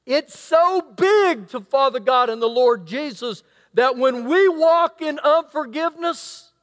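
ordinary talk

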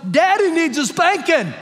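high, emphatic announcement; loud punchline. Acted. Preacher to the congregation about his own faults, loud mock confession. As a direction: belt it out high as a comic confession.

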